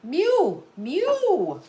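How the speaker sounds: high, drawn-out pet call. Real, not acted. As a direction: coo the name way up high. Calling to the pet.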